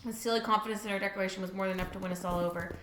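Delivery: low, soft, quick narration; understated brisk story reading; reading game narration. A narrator's reading. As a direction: read it fast and quietly, evenly.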